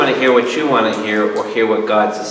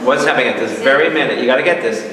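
usual speaking voice

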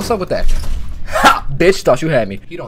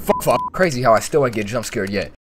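ordinary delivery

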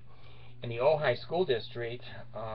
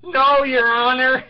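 higher, louder, slow drawn-out answer; mock reply; 12 semitones higher, 10 dB louder, slower than usual. The Bard mimicking the attorneys to the judge, loud and slow. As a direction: throw their own words back at them.

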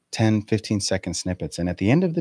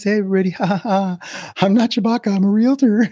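mock high gushing realtor; 10 semitones higher than usual. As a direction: gush like a bubbly realtor on TV. Mock realtor on Ellen show.

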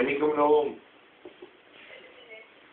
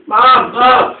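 loud call across the house, higher; shouting; 9 semitones higher, 13 dB louder than usual. Real, not acted. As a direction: yell for mom. Calling out to mom.

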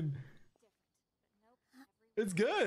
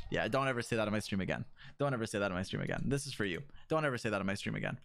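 lower, fast mock-threatening scold; mock stern warning; 10 semitones lower, much faster than usual. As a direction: lay down the law to chat, half joking. Scolding chat, repeated.

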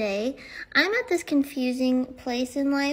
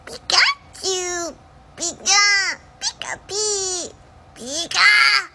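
high, loud squeaky cartoon cry; Pikachu voice; 6 semitones higher, 7 dB louder, much slower than usual. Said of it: "with a cartoon impression".

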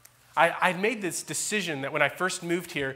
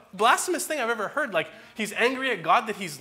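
ordinary delivery

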